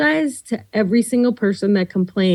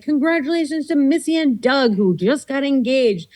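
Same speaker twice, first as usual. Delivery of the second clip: radio announcer read; 4 semitones higher than usual. Retold or imitated. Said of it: "And I'd be like".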